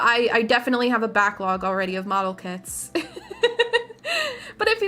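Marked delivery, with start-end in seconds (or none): 3.0-4.5 squeaky high giggle. Real, not acted. High laugh.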